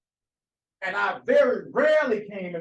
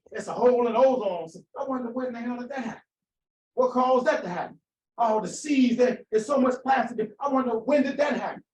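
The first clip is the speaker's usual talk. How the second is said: louder, higher, hammering each phrase home; preaching at full force; 4 semitones higher, 5 dB louder than usual. Acted. Preacher driving the point home to his congregation, full-force preaching. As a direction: preach it hard to the room. Preaching about the planet's harm.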